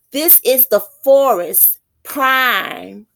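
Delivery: poem reading. Shown as a demonstration. Reads poem line.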